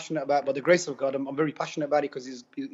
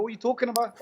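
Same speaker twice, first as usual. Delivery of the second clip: higher-pitched incredulous retort; retold indignant disbelief; 7 semitones higher, faster than usual. Patient retorting to a nurse who downplays the procedure, retold indignation. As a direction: challenge the nurse, imitating your own disbelief. Acted argument with dismissive nurse.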